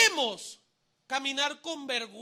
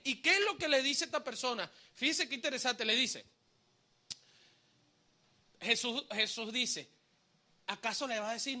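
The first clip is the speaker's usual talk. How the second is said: ordinary talk, nothing marked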